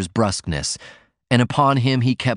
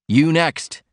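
a raised shout, higher in pitch; 7 semitones higher than usual. A narrator's reading. Martin turning on Mister Ford, a raised shout. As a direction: call out the next man sharply. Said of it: "he shouted at Mister Ford".

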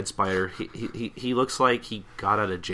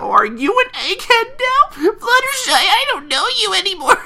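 much higher, louder, hammed-up sob; mock crying; 8 dB louder than usual. Rainbow Dash mock sobbing at nerdy Fluttershy, high and loud. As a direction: wail it like a betrayed cartoon kid. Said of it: "I guess she's crying or something".

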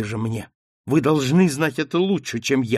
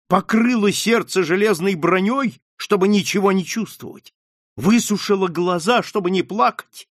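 higher, louder passionate tirade; unstoppable outburst; 6 semitones higher, 4 dB louder than usual. Acted. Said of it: "не умолкала Маргарита".

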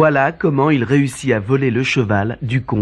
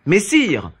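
bold; 7 semitones higher than usual. Acted. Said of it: "répondit le jeune homme hardiment".

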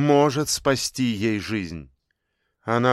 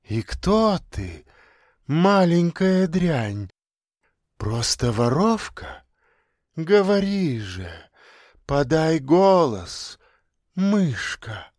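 icy whisper; 3 semitones higher, slower than usual. A narrator's reading. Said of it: "ледяным шёпотом спросил манекен".

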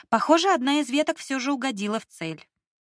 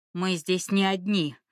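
through gritted teeth; 3 semitones lower than usual. Acted. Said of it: "процедил он сквозь зубы".

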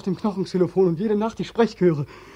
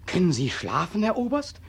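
ordinary talk

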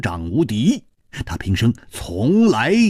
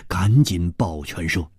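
ordinary delivery

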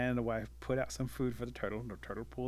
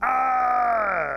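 long, high, loud yell; pained scream; 13 semitones higher, 14 dB louder than usual. Acted. Turtle hitting the rock underwater, screaming. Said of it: "you hear this".

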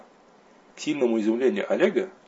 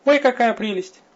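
high, louder squeal of delight; squeaky delight; 13 semitones higher, 5 dB louder, slower than usual. A narrator's reading. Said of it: "Тихо пискнув".

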